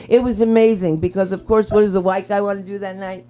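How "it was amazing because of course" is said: ordinary talk, nothing marked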